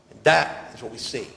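ordinary talk

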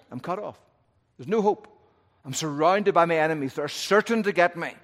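high, loud, rising panic; desperate cry. Acted. David besieged in the city crying out in loud despair. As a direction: cry out as if trapped by enemies.

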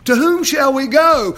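loud, high cry of the question; loud preaching cry. Shown as a demonstration. Preacher echoing Peter's question, loud and high. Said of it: "whose disciples shall we be".

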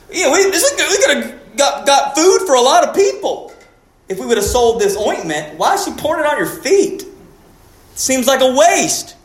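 mock indignant disciple, pitched high; Judas complaining. Judas grumbling about the poured ointment, mock indignant. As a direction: scoff at the wasted ointment.

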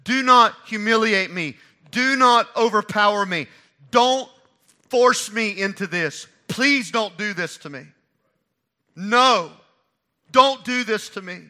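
loud, pressing plea, slowing on force me; loud pleading. Retold or imitated. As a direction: beg him loudly to stop. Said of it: "what she's saying to her brother is".

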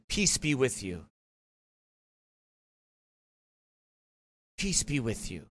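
soft, low pious send-off, mimicked; imitated pious blessing. Man retelling an opponent's parting blessing, mock pious. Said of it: "he pulls the whole".

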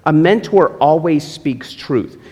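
ordinary delivery

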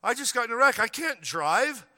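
a hint of a panicked teen pleading; protesting daughter. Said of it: "Gloria protesting".

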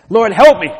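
loud high plea to God; crying out. Acted. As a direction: cry out desperately for help. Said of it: "I need to cry to Jesus Christ".

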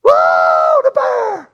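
loud startled holler. Retold or imitated. Neighbor camper yelling at the bear at his grill, loud holler. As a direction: yell it out like a man spotting a bear. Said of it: "we hear him hollering".

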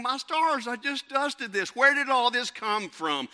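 high, loud, slow exasperated amazement; mock astonishment. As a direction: exclaim it in hammed-up disbelief. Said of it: "and you think".

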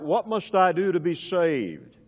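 ordinary talk, nothing marked

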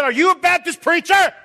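high, loud suspicious priest; suspicious question. Retold or imitated. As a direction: catch him out, suspicious. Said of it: "He said".

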